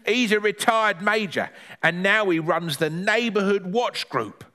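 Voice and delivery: a hint of granny explaining the neighbour; Granny figure, explaining.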